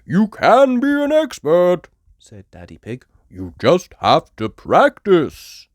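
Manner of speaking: lightly voiced encouraging dad; a hint of a kind father; Daddy Pig. A narrator's reading.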